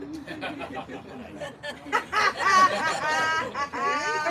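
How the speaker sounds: warm chuckle; laughing. Real, not acted. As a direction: laugh fondly. Laughing at memory of fights.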